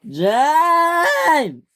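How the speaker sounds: high, long, loud call of a name. Acted. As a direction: bellow the name across the yard. The boss calls out angrily.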